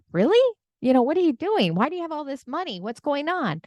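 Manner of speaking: high-pitched, disbelieving mock questioning; incredulous father. Father questions son about hidden money, incredulous. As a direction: act out a dad's startled demand, pitch leaping up.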